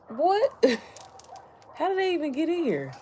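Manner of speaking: sudden high-pitched surprise; startled. Real, not acted. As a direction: jump up in pitch at the bugs. Startled by ladybugs indoors.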